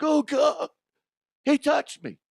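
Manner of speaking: high, loud mock crybaby whine; Jokic. Mocking a player complaining about a touch, whiny. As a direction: wail it like a hammed-up victim. Mocks player crying over contact.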